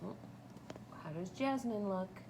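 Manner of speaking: ordinary delivery, nothing marked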